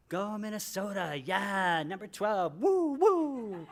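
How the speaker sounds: loud, high whooping cheer for Minnesota. Retold or imitated. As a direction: cheer like the home team just won. Cheering the vote result.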